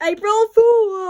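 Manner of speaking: loud, high, drawn-out reveal; slow, crowing prank shout; gleeful shout. Pretend. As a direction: yell the punchline triumphantly. Revealing April Fools prank.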